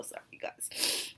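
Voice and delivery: breathy whispered apology, choked up; tearful whisper. Real, not acted. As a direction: whisper it through tears. Apologises while tearing up.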